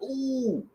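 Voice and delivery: long high-pitched "ooh"; retold high "ooh" of dismay; alarmed "ooh". Sees cops through the peephole.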